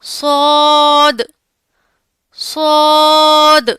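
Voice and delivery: صاد drawn out slowly with a full mouth, loud; full-mouthed saad. Shown as a demonstration. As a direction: fill the mouth and hiss on صاد. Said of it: "منہ بھر کر اسے ادا کرنا ہے". (منہ بھر کر صاد)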